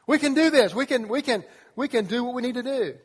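rally the team with it; excited hype. Retold or imitated. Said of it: "the winners go".

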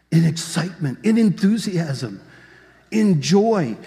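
swelling, emphatic preacher's excitement; rising excitement. Acted. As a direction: build steadily in energy toward a shout. Building toward the crowd's shouting.

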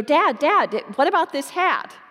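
put-on a kid tugging at her father's attention; insistent little girl. Little daughter voiced in story.

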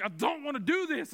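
high, pleading protest; frightened whine as the needles come in; scared plea. Retold or imitated. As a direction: beg your way out of it. Three needles brought in.

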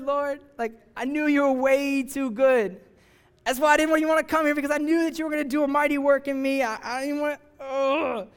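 a sulking prophet griping that God is too good; whiny angry Jonah. Acted. Jonah complains to God, mad.

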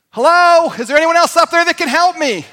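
high, loud shout upward; full-voiced holler for help from the cliff. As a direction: call out hopefully for someone else. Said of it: "So he begins to holler".

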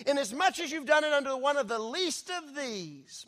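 loud, high scripture reading, building; full-force preaching. Acted. Preacher booming scripture at the congregation, full force. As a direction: thunder the verses out to the congregation.

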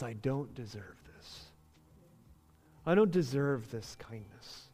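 low, soft, humbled admission; mildly heartbroken. Retold or imitated. Son to his forgiving father, softly heartbroken. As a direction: quietly voice a son undone by kindness. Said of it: "has a hard heart of stone which breaks".

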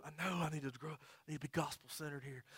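suddenly soft, chastened confession; subdued prayer. Retold or imitated. Stuck driver confessing to God, hushed and humble. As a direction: drop right down into a hushed admission. Acted private prayer after outburst.

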